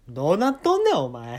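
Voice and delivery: luide, hoge mock uitbrander; geïmiteerde uitval. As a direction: roep het als een geïmiteerde berisping. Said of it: "って言ってきて".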